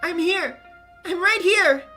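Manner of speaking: loud, insistent calling; urgent shout. Acted. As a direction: make sure she hears you.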